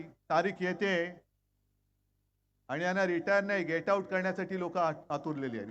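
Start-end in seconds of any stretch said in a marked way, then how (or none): none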